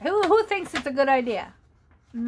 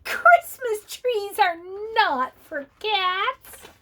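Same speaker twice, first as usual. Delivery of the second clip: playful scolding; 6 semitones higher, slower than usual. A narrator's reading. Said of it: "Emily laughed".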